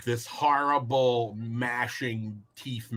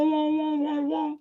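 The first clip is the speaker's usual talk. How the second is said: cartoon sound waves. Shown as a demonstration. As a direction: imitate rippling cartoon sound waves in a high voice. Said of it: "it's that cartoon effect".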